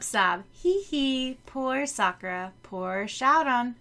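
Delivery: ordinary talk, nothing marked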